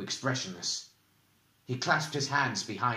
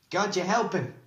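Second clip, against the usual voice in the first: urgent plea; 8 semitones higher, 4 dB louder than usual. A narrator's reading. Pleading for friend under mental attack.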